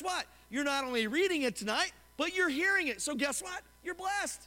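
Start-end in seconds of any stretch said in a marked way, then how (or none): none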